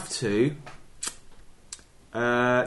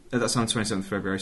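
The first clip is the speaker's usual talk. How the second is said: ordinary talk, nothing marked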